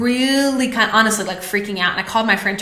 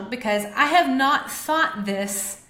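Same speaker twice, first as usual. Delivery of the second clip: emotional; 4 dB quieter, slower than usual. Real, not acted. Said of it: "this makes me a little bit emotional".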